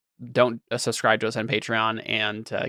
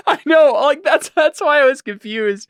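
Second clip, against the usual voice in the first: laughing; 13 semitones higher, 5 dB louder than usual. Real, not acted. Laughing at the lid licking.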